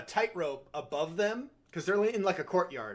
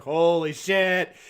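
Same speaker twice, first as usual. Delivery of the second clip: slow, loud, floored; stunned; 6 dB louder, much slower than usual. Retold or imitated. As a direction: let it land slowly in shock. Own reaction to the flaying.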